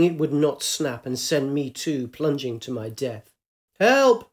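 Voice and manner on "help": loud, high cry for help; desperate shout. Acted. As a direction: shout from the rushing river. Drowning woman calling out.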